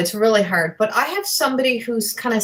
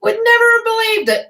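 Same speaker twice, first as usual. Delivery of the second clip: much higher mock grumpy outburst; grumpy disbelief; 12 semitones higher, 4 dB louder than usual. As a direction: play the grump scoffing loud and high. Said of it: "And he's like".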